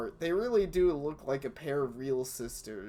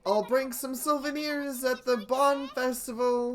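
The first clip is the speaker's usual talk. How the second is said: calling farewell; 10 semitones higher, 5 dB louder than usual. Acted. Shouting farewell from the boat.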